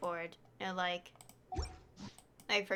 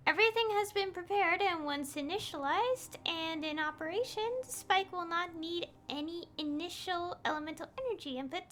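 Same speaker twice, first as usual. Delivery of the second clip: higher-pitched, measured character reading; game dialogue read-aloud; 6 semitones higher than usual. Acted. Streamer voicing an expert explaining the spike, reading aloud.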